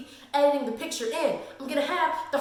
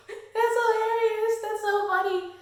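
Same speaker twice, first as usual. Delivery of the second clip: amused delight; 8 semitones higher than usual. Retold or imitated. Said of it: "I was like".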